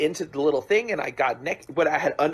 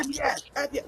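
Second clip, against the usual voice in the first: mock cheering; 10 semitones higher, 5 dB quieter than usual. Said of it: "they're hearing".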